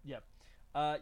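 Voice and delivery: ordinary talk, nothing marked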